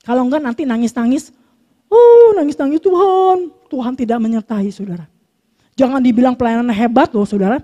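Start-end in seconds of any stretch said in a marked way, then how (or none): 1.9-3.5 mock wailing. Mock crying complaint to God.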